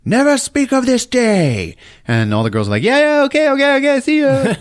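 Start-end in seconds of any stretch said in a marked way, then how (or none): 0.0-1.8 high, slow strained order; mock commanding. Hero to the rescued girls, high mock-solemn command. 2.8-4.5 very high, loud chattering squeal; panicked agreement. Acted. Rescued girls agreeing to the hero, high panicked squeal.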